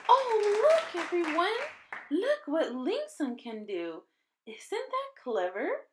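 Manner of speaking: high, excited exclamation; delighted teacher. A narrator's reading. Miss Smith showing the class Ling Sung's trick, excited. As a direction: call the class over in delight.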